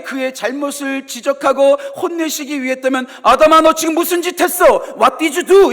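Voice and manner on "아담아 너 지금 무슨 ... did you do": loud, high accusing scold. Retold or imitated. An angry God scolding Adam for his sin, loud. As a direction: rebuke Adam sharply. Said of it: "이렇게 말했을 거예요".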